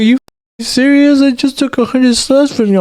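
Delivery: high, exaggerated girlfriend voice; mimicking girlfriend. Boyfriend mimics his girlfriend's outrage, in a high mock voice. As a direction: squeak out her indignant protest.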